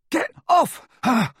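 high-pitched yell in a struggle; desperate shout. A narrator's reading. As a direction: shout it to pull someone off a friend. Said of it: "Ron shouted".

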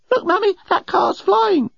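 put-on very high, slow child's voice; amazed small child. A narrator's reading. Small child telling Mummy about the craft, amazed. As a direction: pipe up like an astonished little kid.

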